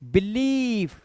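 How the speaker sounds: बिलीफ with the "ee" held long; long vowel. Shown as a demonstration. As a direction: draw out the second syllable of बिलीफ. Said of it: "ये ई है".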